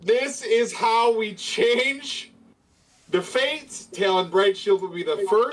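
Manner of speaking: slow, grand hero declaration; heroic proclamation. Acted. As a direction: declare it like a paladin.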